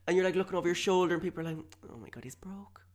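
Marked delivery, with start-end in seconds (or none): 1.9-2.9 hushed, unvoiced aside; gossiping whisper. People in the queue gossiping, scandalised whisper. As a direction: whisper it behind his back.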